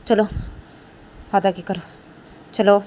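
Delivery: teacher demanding Kajal's hand, loud and stern; stern order. Acted. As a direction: bark it at the student.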